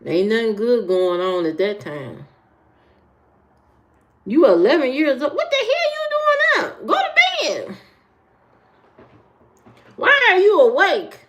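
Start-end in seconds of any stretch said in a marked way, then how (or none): 4.2-7.8 high-pitched, hammed-up outraged scolding; mock scolding. Scolding a viewer awake at four, mock outrage. Mock telling-off of young night owl. 10.0-11.2 high, loud, exasperated mock scolding; mock exasperation. Asking a sleepless viewer, mock exasperation.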